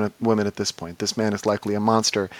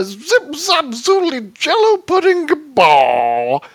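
high-pitched, loud comic babble; hammed-up celebrity caricature, pitch soaring then dropping on the last word; Bill Cosby; 7 dB louder, much slower than usual. Shown as a demonstration. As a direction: spout nonsense in an exaggerated high impression. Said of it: "do an impression of Bill Cosby".

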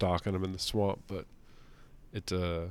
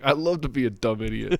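mocking dumb hero; 4 semitones higher, 5 dB louder than usual. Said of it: "It's like".

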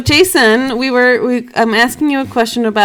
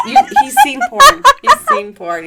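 laughing. Real, not acted. Laughing relay of drunk brother.

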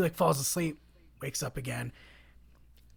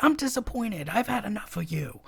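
higher, sharper fed-up retort; fed-up sister; 6 semitones higher than usual. Retold or imitated. Sister turning on Krisha, fed up and higher. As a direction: snap it like you've had enough. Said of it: "in a different tone of voice".

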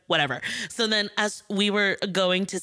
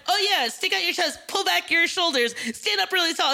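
high-pitched, eager posing instructions; coaching; 10 semitones higher than usual. Retold or imitated. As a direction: mimic a pushy pose coach, pitched up. Voicing people coaching a pose.

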